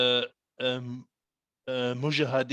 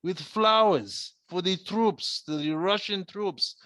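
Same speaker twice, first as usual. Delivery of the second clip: put-on Russian accent; 6 semitones higher than usual. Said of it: "thick Russian accent".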